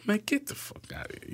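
incredulous brush-off, pitched high and soft; high, hushed mock disbelief; retold disbelief. Son doubting dad's news over the phone, incredulous.

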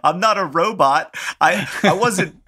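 high mock robotic pilot; a pilot whose programming says panic. Brother continuing the pilot bit, high robot voice.

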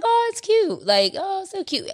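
very high, sing-song cooing; hammed-up high cooing over a cute moment; mock cutesy. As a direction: coo it like a gushing mom. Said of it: "and I was like".

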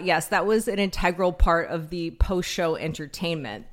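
ordinary talk

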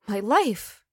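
usual speaking voice

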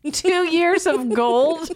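high, slow disbelieving echo; incredulous. Real, not acted. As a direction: repeat it like you can't believe it. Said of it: "Oh my God".

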